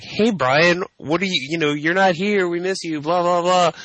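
high-pitched imitation of friends checking in; mock chirpy friends. Voices friends calling from the event.

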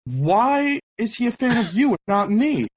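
high-pitched, hammed-up outrage; mock indignation. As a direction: imitate an affronted friend demanding recognition. Friend's retold jealous outrage.

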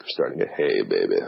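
deep, low crooning voice. Pretend.

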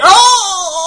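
put-on sudden high yelp of a lost old man; confused old man yelp. Imitating a clueless old driver with a loud high yelp. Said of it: "They're like".

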